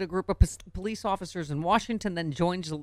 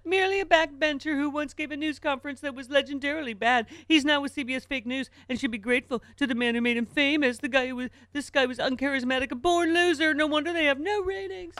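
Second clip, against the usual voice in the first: high, loud mocking Trump voice; Trump impression; 9 semitones higher, 4 dB louder than usual. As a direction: read it as a whiny Trump. Reading Trump's post in character.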